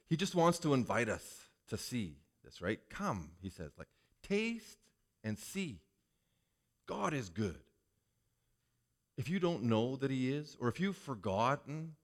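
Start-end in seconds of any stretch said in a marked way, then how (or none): 2.9-7.6 softer, inviting voice; gentle invitation. Acted. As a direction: coax the listener gently. Voicing God's gentle invitation.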